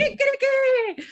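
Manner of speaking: very high rooster kikiriki; rooster crow. Retold or imitated. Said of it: "They say".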